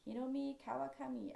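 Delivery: "Hiromi Kawakami" with Japanese vowels and flat syllables; a try at the Japanese way of "Hiromi Kawakami"; Japanese pronunciation. Shown as a demonstration. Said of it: "if I was trying to speak Japanese".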